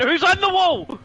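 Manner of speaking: jolted by the crash; excited. Real, not acted. Commentator spotting a car hitting the wall, excited shout.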